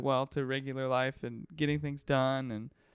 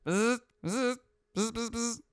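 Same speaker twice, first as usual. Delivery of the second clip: high imitated electric screwdriver buzz; short bursts of mouth-made drill whirr; buzzing tool noise; 7 semitones higher, slower than usual.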